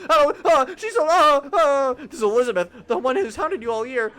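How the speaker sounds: high, loud, rushed flustered babble; flustered inner panic. Acted. Narrator scolds himself over Elizabeth, high and flustered. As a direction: panic at yourself over a crush. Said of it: "Damn, get a grip".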